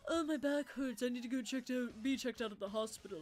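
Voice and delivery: mock whining, mildly hammed-up; milking the injury. Driver mocks complaining woman from the crash, milking it. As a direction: imitate someone playing up an injury. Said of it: "She was milking that fucking shit".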